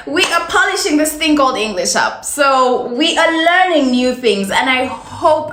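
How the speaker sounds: ordinary talk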